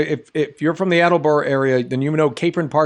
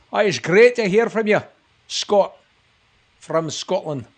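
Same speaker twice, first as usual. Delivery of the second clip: playful put-on Scottish burr, higher pitched; mock Scottish accent; 4 semitones higher than usual. Host says hello to a viewer from Scotland, mock Scottish accent. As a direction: greet them in a hammed-up Scottish accent.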